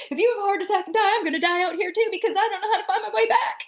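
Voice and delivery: high, very fast, half-laughing mock panic; retold frantic panic. Fearing the old guide dies out there, frantic mock panic. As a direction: rattle it off in a laughing frenzy. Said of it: "I'm like".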